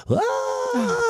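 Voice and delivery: high-pitched "aah" at moderate volume; mock yell while sliding away; imitated falling scream. Imitating himself sliding down the mountain, high mock scream. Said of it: "you can hear me going down".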